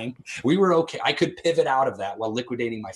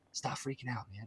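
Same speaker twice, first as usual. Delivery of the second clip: much softer, calming rebuke; quietly voiced wife; 15 dB quieter than usual. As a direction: hush him down gently. Wife calming him, retold.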